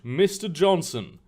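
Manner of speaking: usual speaking voice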